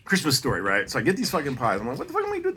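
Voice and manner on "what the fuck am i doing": mock panic. Self-quote, high pitched disbelief.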